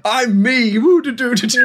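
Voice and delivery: silly sing-song impression of oneself; mock impression of yourself. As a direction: ham up being yourself, a little lost. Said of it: "Do an impression of yourself".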